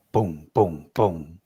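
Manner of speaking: three low, separate booms; deep, evenly spaced thuds in the voice; imitated stomps. Witness imitating the creature's answer, low booming stomps. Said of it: "These three stomps".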